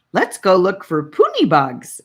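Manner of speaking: eager, loud and bright; a kid's loud eager invitation; excitedly. A narrator's reading. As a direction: blurt it out raring to go.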